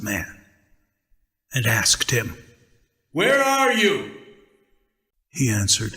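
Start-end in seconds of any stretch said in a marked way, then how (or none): 3.1-4.1 high, ringing call; God calling for the hidden man. Acted. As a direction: call across the garden.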